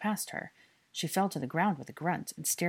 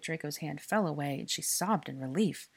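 ordinary delivery, nothing marked

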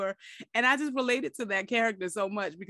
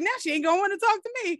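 higher, fast, annoyed complaint; mock annoyed friend; 12 semitones higher, 4 dB louder, faster than usual. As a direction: grumble that you blew it. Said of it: "He's like".

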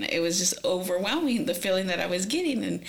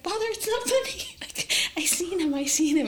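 high-pitched mock protest; retold desperate pleading; 11 semitones higher than usual. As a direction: plead to be believed, acting it out high. Retold pleading while others laugh.

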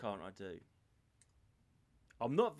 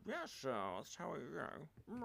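Partridge broadcaster voice; 14 dB quieter, slower than usual. Retold or imitated. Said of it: "He's got that kind of".

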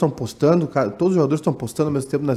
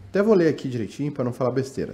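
ordinary delivery

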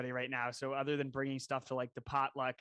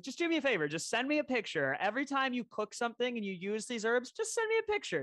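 higher, needling guilt trip to a friend; 8 semitones higher than usual. Retold or imitated. Friend mock-guilts a friend over a gifted herb garden, needling. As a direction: pile on the guilt, mock-wounded.

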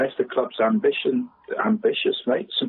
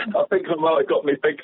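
laughing; 4 semitones higher than usual. Real, not acted. Chuckling at own joke.

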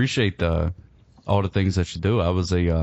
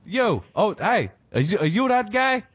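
much higher, eager calling out to a stranger; excited calling out; 11 semitones higher than usual. Retold or imitated. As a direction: hail him across the room, thrilled. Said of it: "and I'm like".

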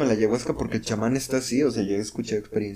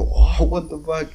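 stunned disbelief; 4 semitones higher, 6 dB louder, slower than usual. Retold or imitated. Stunned by distorting feet.

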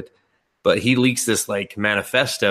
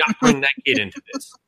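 high mock protest; 11 semitones higher, faster than usual. Scolding the movie.

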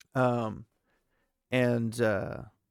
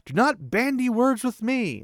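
much higher and louder, a commanding roar; 12 semitones higher, 8 dB louder than usual. A narrator's reading. Lan roaring at Nynaeve to stop arguing. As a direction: roar the order at her. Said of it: "and he roars".

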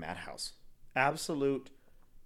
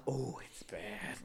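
slow whispered echo; 10 dB quieter, much slower than usual. Retold or imitated. Echoing her friend's whispered line, slow and hushed. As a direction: echo it back slowly in a breathy hush.

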